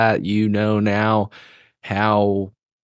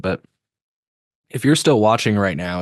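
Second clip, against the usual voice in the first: ordinary talk, nothing marked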